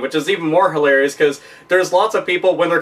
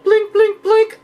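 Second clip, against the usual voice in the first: much higher beeping blink noises; blinking game sound; 3 dB louder, much slower than usual. Pretend. Imitating the urinal game screen blinking, much higher beeps. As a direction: beep like a flashing arcade screen.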